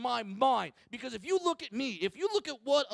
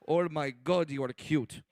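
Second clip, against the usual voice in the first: much lower voice, cooing over a cute little one; cooing terrorist; 9 semitones lower than usual. Acted. Terrorist coos at small man.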